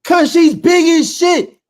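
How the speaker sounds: slow, loud, high shout. Real, not acted. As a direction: bellow it out, drawn out. Heated sports argument, shouting.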